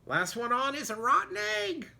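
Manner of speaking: squeaky hen taunt; chicken voice. Acted. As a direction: tease like a cheeky hen. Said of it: "what she thought was".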